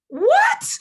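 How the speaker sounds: loud, very high "what"; shocked disbelief. Acted. Friends reacting to her engagement, loud high shock. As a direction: shriek it in mock astonishment.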